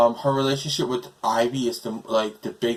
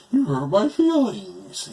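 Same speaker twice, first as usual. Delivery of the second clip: much higher, slow, wounded whine; mocking Bane, pitched way up and sulky; whiny Bane; 10 semitones higher, slower than usual. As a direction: play Bane as a big baby with hurt feelings. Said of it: "And he's like".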